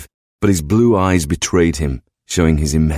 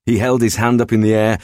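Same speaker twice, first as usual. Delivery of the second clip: plain reading voice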